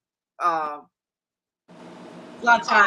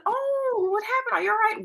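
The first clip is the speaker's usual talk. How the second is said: high-pitched worried questions; alarmed concern; 13 semitones higher than usual. Retold or imitated. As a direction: sound alarmed for the grandson. Alarmed reply to caller.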